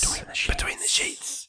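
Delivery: soft echoed whisper. Shown as a demonstration. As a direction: whisper it back twice. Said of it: "echoing the whispered title".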